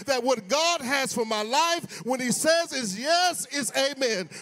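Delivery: loud, punching preacher's cadence; full-force preaching. Acted. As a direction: hammer each line home.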